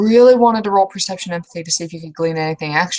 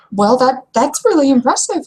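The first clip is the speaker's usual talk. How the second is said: impressed; 6 semitones higher, 4 dB louder than usual. Acted. GM character whispers then exclaims.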